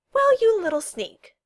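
high, loud and drawn out; indignant scolding. Acted. Girl scolds an absent sneak, indignant and loud. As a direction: call out the culprit, slowly. Scolding someone who slipped away unseen.

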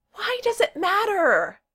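high, loud drawn-out plea; begging. A narrator's reading. Kel begs her friends to stop guessing, pleading. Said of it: "Kel all but begged".

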